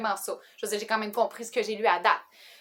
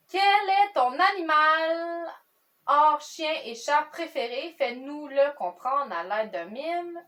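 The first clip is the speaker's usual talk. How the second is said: very slow, higher reading voice; question read-out; 5 semitones higher, 3 dB louder, much slower than usual. Real, not acted. Reading question eight to viewers, slow drawn-out read. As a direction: draw out each word of the card.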